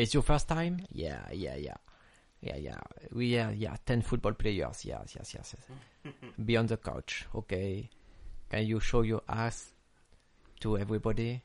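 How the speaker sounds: hammed-up adult-film English, dropping low; mock porn actor. Showing English learned from Pornhub, mock porn actor. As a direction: parody a porn scene, sinking into soft low murmurs. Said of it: "j'ai appris à parler anglais sur Pornhub".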